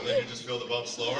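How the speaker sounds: ordinary talk, nothing marked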